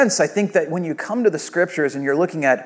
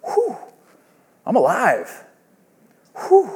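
acted relief; 3 semitones higher, 4 dB quieter than usual. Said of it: "you feel this sense of".

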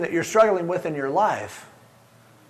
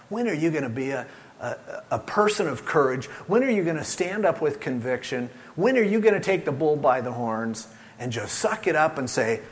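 usual speaking voice

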